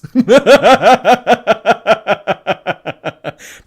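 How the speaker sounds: loud, high burst of laughter; cracking up. Real, not acted. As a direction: laugh hard, then let it die away. Cracks up at the caller's voice.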